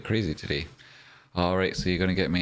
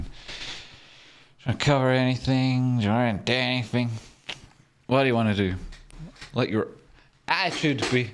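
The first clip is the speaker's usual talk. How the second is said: playful put-on delivery; 6 semitones higher than usual. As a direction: stretch it out high and playful. Playful put-on hosting of chat.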